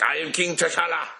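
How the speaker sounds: high put-on African accent; proud king in an African accent. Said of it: "I will do my African voice".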